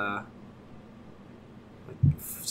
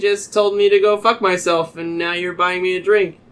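higher, louder baffled protest; mock incredulous; 6 semitones higher, 4 dB louder than usual. Him answering the stranger who bought drinks, incredulous. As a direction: question his sudden generosity in disbelief.

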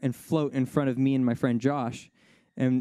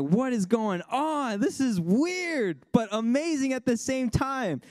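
amazed; 10 semitones higher, slower than usual. Retold or imitated. Amazed at floating bubbles.

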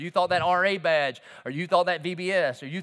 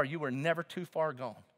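ordinary talk, nothing marked

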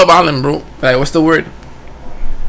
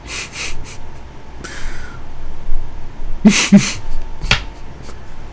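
silent wheeze laugh; 2 semitones higher, 8 dB quieter than usual. Real, not acted.

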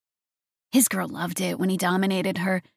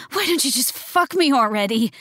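snapping; 5 semitones higher than usual. A narrator's reading. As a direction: bite out the demand. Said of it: "she snapped".

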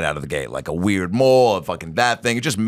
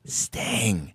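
quiet story version; 8 dB quieter than usual. Retold or imitated. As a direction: say it softly, only hinting at the shout. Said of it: "I'm doing the quiet story version".

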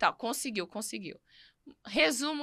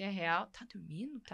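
hushed disbelief; 12 dB quieter than usual. Acted. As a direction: check softly, as if beside a sleeper. Acts mother checking sleeping baby. (baixinho, incrédula)

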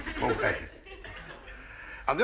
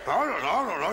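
mock panic; 11 semitones higher than usual. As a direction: fret in a comic panic. Panicked family at a loss.

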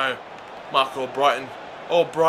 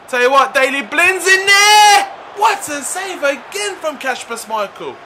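high, loud rush into exclamation; excited shout; 8 semitones higher, 5 dB louder than usual. Real, not acted. Gamer watching the keeper's save, excited shout. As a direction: blurt it as the keeper saves. Goalmouth scramble.

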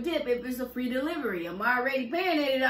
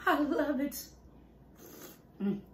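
ordinary talk, nothing marked

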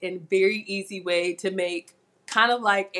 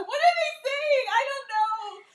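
high, bewildered squeal; baffled high-pitched squeal at the chat. Real, not acted. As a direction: squeak out the confusion, close to laughing. Reading baffling live chat.

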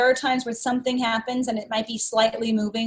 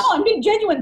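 mock earnest protest; 8 semitones higher than usual.

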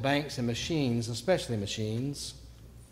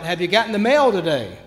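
loud, higher, sing-out holler; demonstrated shout; 4 semitones higher, 10 dB louder than usual. Acted. Showing what he can shout, a loud holler. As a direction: shout it across the yard. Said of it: "I can shout".

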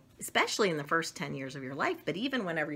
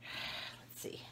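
whisper; 17 dB quieter than usual. Real, not acted. About to shoot a rubber band.

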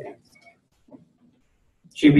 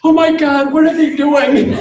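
much higher and louder, mock panic of a worried father; mock alarmed dad; 12 semitones higher, 7 dB louder than usual. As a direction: yelp it like a dad hearing bad news. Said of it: "I went".

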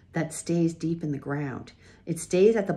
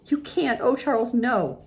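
high, pleading cry; desperate pleading; 8 semitones higher than usual. A narrator's reading. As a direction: beg him to stay.

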